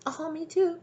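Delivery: very high imitation of a tag-along; mock me-too. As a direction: mockingly pipe up, pitched way high. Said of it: "and be like".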